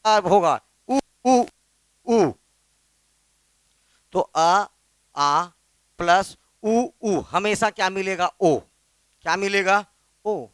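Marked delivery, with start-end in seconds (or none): none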